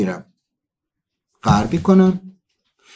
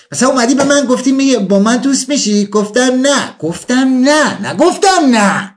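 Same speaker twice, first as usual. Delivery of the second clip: put-on coy girl; 8 semitones higher than usual. Acting coy girl's teasing no.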